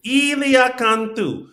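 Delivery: high, slow, drawn-out name call; sing-song announcer call of the name; Tony's name call. Retold or imitated. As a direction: belt it like the host. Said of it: "says it".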